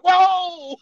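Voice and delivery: high loud startled cry; startled whoa. Retold or imitated. New private discovering the trashed room, loud and high. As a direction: gasp at the wrecked room. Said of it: "he's like".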